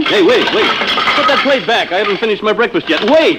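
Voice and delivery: rising panicked shout after the robot; yelling at robot. Acted. Husband yelling at the robot clearing his plate, rising shout. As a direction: shout at the machine to stop it taking the plate.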